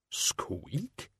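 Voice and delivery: a quiet puzzled squeak; soft questioning squeak. Acted. Death of Rats squeaking in shock, soft and questioning.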